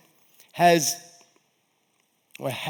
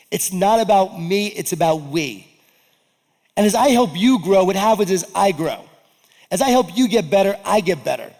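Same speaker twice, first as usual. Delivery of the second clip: louder, higher rousing sermon delivery; preaching; 5 semitones higher, 7 dB louder than usual. Acted. Speaker rallying the audience on leadership, loud preaching. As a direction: drive the message home to the crowd.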